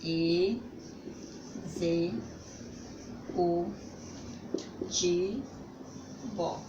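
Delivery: e, zu, ti, vo, each syllable spoken apart; separated syllables. Shown as a demonstration. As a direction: break "executivo" into syllables with pauses. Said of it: "Vamos separar". (sílabas separadas com pausas)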